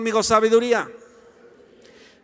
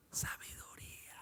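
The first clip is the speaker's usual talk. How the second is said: hushed whispered reveal; very soft, breath-only whisper; 23 dB quieter than usual. Real, not acted. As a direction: whisper the key word with awe. Stage whisper for the key word.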